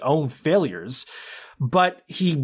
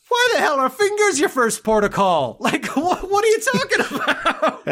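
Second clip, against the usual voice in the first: baffled disbelief; 13 semitones higher than usual. Retold or imitated. A man baffled by his friend's finger threat, high incredulous. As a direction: squeak out his disbelief through a laugh. Imitating Farrell's baffled reply.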